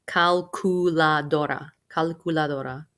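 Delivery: Calculadora with a clear CU in the middle, cal-CU-la-do-ra; don't swallow the cu in Calculadora; clear cu syllable. Shown as a demonstration. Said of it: "You do have to say the word cu in the middle".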